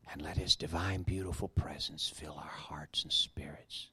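low, slow, breathy hush, words drawn out; whispered prayer. Acted.

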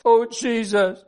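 grieving child's cry, lightly acted. A narrator's reading. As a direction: act out a little girl crying instead of screaming. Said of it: "began to cry instead of scream".

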